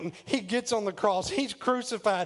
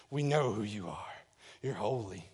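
much lower, softer voice; hushed awe; 12 semitones lower, 7 dB quieter than usual. Acted. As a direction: drop to a low, reverent hush. Same cry repeated in hushed awe.

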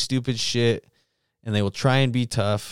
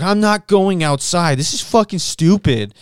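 put-on stubborn drunk woman refusing, pitched up; mock drunk lady; 8 semitones higher, 6 dB louder than usual. Drunk lady refusing the other bathroom, loud high whine.

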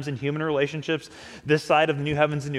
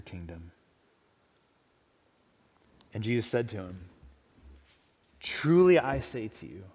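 scripture reading. Acted. Reads scripture aloud.

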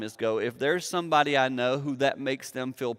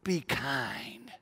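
soft whisper; 7 dB quieter, slower than usual. Real, not acted. Preacher's intimate appeal.

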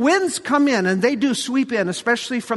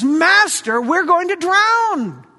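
panicked cry; 7 semitones higher, 4 dB louder than usual. Acted. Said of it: "they cried out to Jesus".

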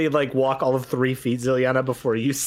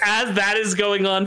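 normal talking voice

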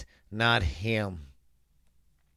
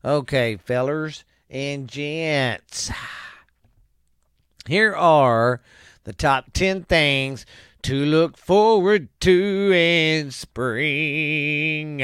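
announcer voice; 5 semitones higher, 7 dB louder, much slower than usual. Acted. Host announcing list.

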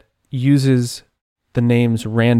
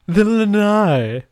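mock posh; 6 semitones higher, slower than usual. Mocking Caleb's word.

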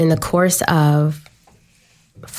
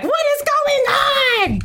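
mock cry of disbelief; slower than usual. Calling friends in disbelief.

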